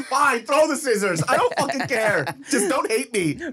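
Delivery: high, loud, laughing surrender; mock desperate pushover. Pushover dad begging daughter not to hate him, high mock pleading. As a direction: give up with hammed-up pleading.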